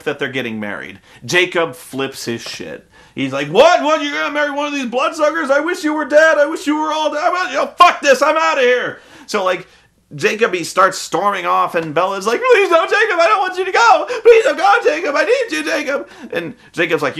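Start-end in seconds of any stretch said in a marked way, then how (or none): 3.5-9.0 high, hurried angry tirade; imitating someone flipping out; mock outrage. Jacob flips out in retelling. 12.4-16.1 high-pitched mock begging; imitating a frantic lovesick girl; Bella pleading. Bella begs Jacob not to go.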